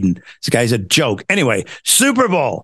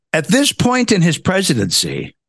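usual speaking voice

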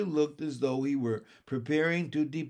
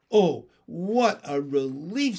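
relieved, higher and louder exclamation; sighing relief; 4 semitones higher, 7 dB louder than usual. A narrator's reading. Miss Lark sees nothing is the matter, sighing relief. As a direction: let a relieved sigh carry out loudly. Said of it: "sighing loudly".

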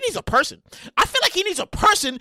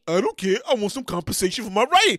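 deadpan insistence; low flat writer voice; 5 semitones lower than usual. Pretend. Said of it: "the two alter egos".

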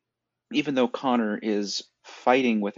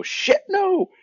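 high, loud outburst; emphatic refusal; 7 dB louder than usual. Acted. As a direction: exclaim it in sharply raised pitch. Mock present-day reaction to old tropes.

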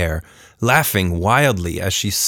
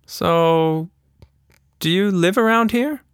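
put-on higher girl voice asking; curious Tilda; 10 semitones higher than usual. A narrator's reading. Tilda in the treetop asking the creature, girl voice. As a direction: ask it like a curious kid.

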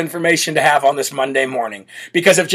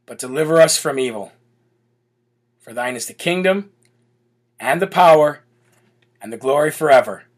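recited Lord's Prayer, hushed and unhurried; 4 semitones lower, 4 dB quieter than usual. Acted.

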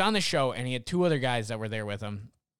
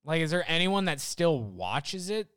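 ordinary talk

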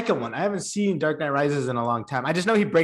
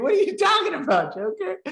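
much higher, mocking squeak; 13 semitones higher than usual. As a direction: squeak it out in mock outrage. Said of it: "And he's like".

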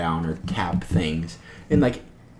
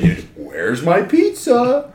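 slow, drawn-out, higher mock catchphrase; imitating Cameron's line; 9 semitones higher, 3 dB louder, much slower than usual. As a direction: stretch out pizza like the coworker does. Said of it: "his go-to is".

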